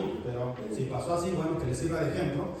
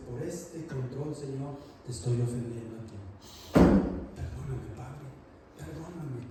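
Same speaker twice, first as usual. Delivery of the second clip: sincere prayer; 3 semitones lower than usual. Retold or imitated. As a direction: ask forgiveness humbly. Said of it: "agarra el control y dice".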